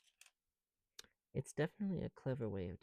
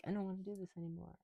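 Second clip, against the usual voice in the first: whiny computer; 8 semitones higher, 4 dB quieter, faster than usual. Retold or imitated. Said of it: "It's like".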